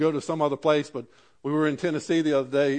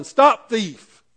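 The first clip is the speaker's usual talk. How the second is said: louder, higher mock yell; 4 semitones higher, 6 dB louder than usual. Woman chasing the boy out the door, mild mock yell. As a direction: call it out after the thief. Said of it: "the woman yelling".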